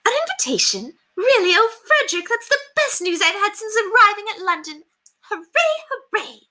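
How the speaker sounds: very high, gushing squeals of joy; overjoyed society lady cheering; suddenly delighted. Acted. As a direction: melt from scorn into giddy delight. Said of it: "mood changed on the instant".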